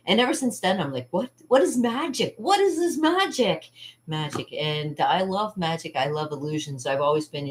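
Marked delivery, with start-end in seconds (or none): 1.1-3.7 high, swooping questions, slowing down; acted wonder. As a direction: marvel with mock amazement. Acts out her wonder at magic.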